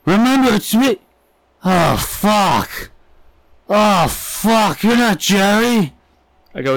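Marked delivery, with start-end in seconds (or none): 0.0-1.0 high, pleading stranger voice; a stranger at the pump insisting he knows him; odd stranger. Retold or imitated. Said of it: "He goes". 1.6-5.9 high, slow, dismayed realization; a stranger realizing he followed the wrong man. Retold or imitated. Stranger realizes his mistake.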